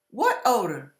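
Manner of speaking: soft, innocent sweet reply. Retold or imitated. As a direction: answer sweetly as if nothing smells. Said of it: "So sweet".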